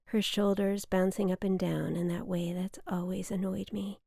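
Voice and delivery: ordinary delivery, nothing marked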